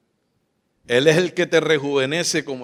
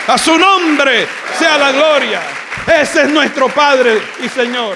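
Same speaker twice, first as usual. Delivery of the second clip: much louder, much higher, impassioned preaching; full-force preaching; 8 semitones higher, 10 dB louder than usual. Real, not acted. Pastor to congregation, impassioned preaching at full voice. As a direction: shout it out, carried away with fervour.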